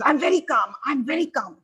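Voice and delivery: mock an upset son protesting he's calm; agitated teen son.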